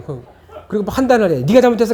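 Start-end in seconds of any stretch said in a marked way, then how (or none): 1.5-1.9 higher, louder accusing question; mock interrogating parent. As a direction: grill the child like a suspect. (아이를 추궁하는 부모 흉내)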